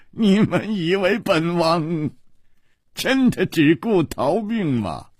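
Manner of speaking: high, drawn-out regal taunt; laughing king's boast. A narrator's reading. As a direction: stretch the words grandly, pitched high. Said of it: "大笑". (大笑后得意地高声反问)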